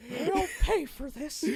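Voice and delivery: high, slow, strained cartoon voice; hammed-up vow of revenge through a laugh; cartoon character quote. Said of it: "the cutscene in Kingdom Hearts two".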